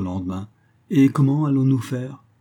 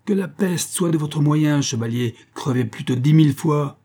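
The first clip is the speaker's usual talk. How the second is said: angry curse; 6 semitones higher, 3 dB louder than usual. A narrator's reading. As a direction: spit the curse at the rival. Husband curses the rival.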